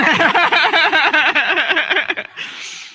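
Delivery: loud high burst of laughter; hearty laugh; big laugh. Real, not acted. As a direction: laughs out loud at her own joke.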